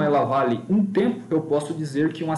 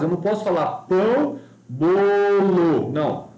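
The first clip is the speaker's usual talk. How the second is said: wrong rhythm. Shown as a demonstration.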